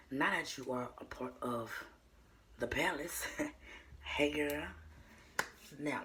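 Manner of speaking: ordinary talk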